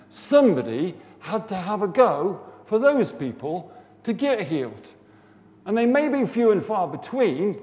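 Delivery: high, loud, impassioned urging; rally the room with rising passion; preacher carried away by feeling; real emotional fervour. Real, not acted. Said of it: "I'm getting emotional about this".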